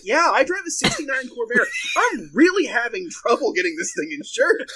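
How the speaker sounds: high-pitched, put-on exasperated caller; earnest prank customer. Baiting an insurance scam caller, mock-troubled customer. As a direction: plead with mock frustration.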